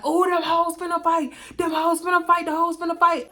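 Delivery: high, gleeful teasing chant; mocking passersby. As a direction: sing it out like hecklers.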